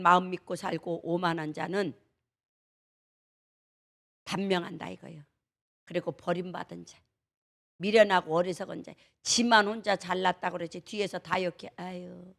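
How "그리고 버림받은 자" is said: usual speaking voice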